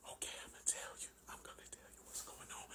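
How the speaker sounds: hushed, voiceless whisper. Retold or imitated. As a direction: imitate a wife whispering because her throat hurts. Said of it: "all this whispering".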